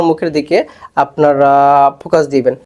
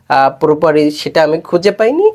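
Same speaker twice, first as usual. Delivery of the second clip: ordinary talk, nothing marked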